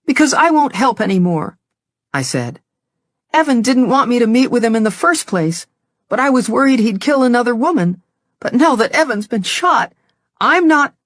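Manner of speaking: defiant but close to tears; distressed daughter. Acted. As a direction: daughter justifying herself to her angry father. Daughter pushes back at dad.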